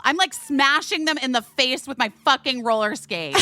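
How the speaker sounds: normal talking voice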